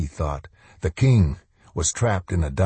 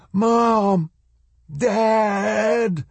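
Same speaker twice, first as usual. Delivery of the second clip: high, drawn-out call; yelling. A narrator's reading. Boy searching for his lost parents, yelling. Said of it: "he yelled".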